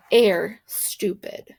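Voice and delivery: ordinary delivery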